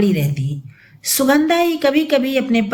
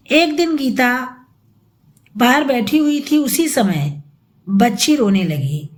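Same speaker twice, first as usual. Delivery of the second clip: usual speaking voice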